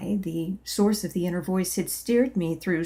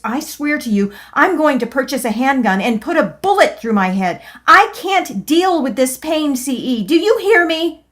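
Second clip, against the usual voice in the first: much higher, much louder yelling; angry desperate yell; 7 semitones higher, 8 dB louder than usual. Acted. In pain, yelling at C E, a much higher, louder angry cry. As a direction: rage at C E in despair. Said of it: "I yelled".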